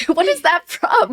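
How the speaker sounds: asked through a laugh; amused, laughing aloud; laughing question. Real, not acted. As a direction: giggle while you ask it.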